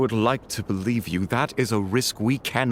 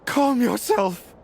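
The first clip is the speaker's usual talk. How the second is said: high, slow pleading; urgent plea; slower than usual. Acted. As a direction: beg her to settle down.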